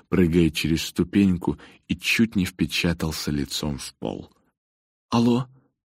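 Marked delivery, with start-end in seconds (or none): 5.1-5.5 hoarse. Acted. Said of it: "прохрипел он".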